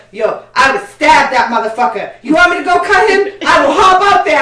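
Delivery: loud, high, fired-up street threat; high, loud eager offer to go fight; hyped-up threat. Retold or imitated. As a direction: shout it like you're ready to jump in. Neighbor kid offers to fight.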